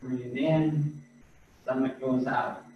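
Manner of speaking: soft, unhurried breath counting, each cue left to hang; guided breathing cues. Acted. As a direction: guide the class through each breath, quietly. Leads slow breathing cues.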